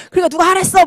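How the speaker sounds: high-pitched imitation of a blaming husband; mock scolding. Husband blaming wife who confides in him, mock scolding. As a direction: throw it back as a hammed-up reproach.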